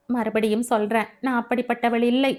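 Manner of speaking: ordinary delivery, nothing marked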